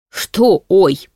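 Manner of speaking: demon's indignant growl; growled question. A narrator's reading. Demon snaps at the heroine's oops, growling. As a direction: growl it back in disbelief. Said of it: "прорычал демон".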